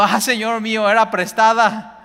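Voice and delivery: loud, high wail of dismay; anguished cry. Acted. Woodcutter crying to the prophet over the lost axe, loud high wail. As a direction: cry out as the borrowed axe sinks. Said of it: "y gritó diciendo".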